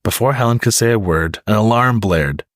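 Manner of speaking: ordinary delivery, nothing marked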